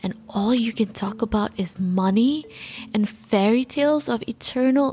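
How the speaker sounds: hammed-up impassioned activist speech, rising and scolding; imitating Greta Thunberg. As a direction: rail at world leaders like a young activist. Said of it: "this is my poor imitation of it".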